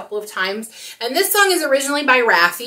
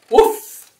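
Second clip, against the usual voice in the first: dog bark; 4 semitones higher, 10 dB louder than usual. Acted. Dog in the book.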